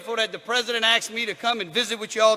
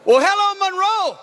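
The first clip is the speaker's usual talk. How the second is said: excited crowd greeting; 9 semitones higher than usual. Real, not acted. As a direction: fire up the crowd.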